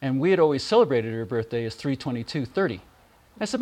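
ordinary talk

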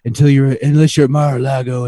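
loud mock presidential voice; Trump impression. Mocking the president's announcement.

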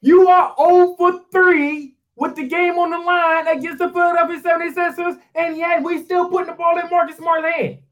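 high-pitched, incredulous sports rant; outraged yelling. Real, not acted. Fed-up host berating Marcus Smart's record, outraged yelling. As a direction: yell it out in sheer exasperation.

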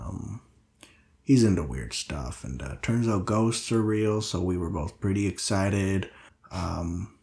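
low, quiet, halting role voice; subdued Linus, weary and hesitant. Acted. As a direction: speak low and softly, with hesitant pauses. Game master voices Linus.

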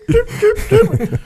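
mock choked squeak, very high, laughing; imitating a breathless squeak; high squeaky tip. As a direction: squeeze out tiny high tips through a laugh. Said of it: "all I could do is tip".

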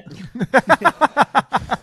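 big high burst of laughter; laughing hard at his own tease; loud laugh. Real, not acted.

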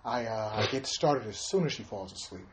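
low, very soft celebrity impression; mock confession, quietly in character; Bill Cosby.

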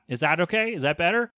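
ordinary delivery

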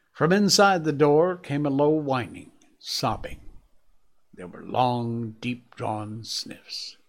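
ordinary delivery, nothing marked

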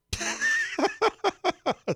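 sudden high giggle; involuntary giggle. Real, not acted. Host catching himself laughing at a death joke, high giggle. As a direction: burst into an unplanned high laugh. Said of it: "I started laughing like that".

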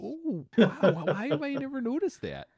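high, marveling folksy character; amazed Harold. Acted.

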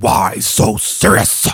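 very loud, slow villain delivery; hammed-up villain. Son to his mom in a mock origin story, loud hammed-up menace. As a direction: blast it out slowly with hissed S sounds. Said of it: "I looked at my mom and I said".

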